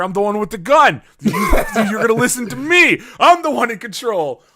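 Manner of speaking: loud, high mock bravado with a laugh breaking in; hammed-up show of being in charge; cocky command. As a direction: lay down the law, playing it big. Acts out bossing werewolves at gunpoint.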